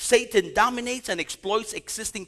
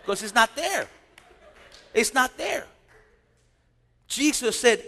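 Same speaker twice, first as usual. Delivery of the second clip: ordinary talk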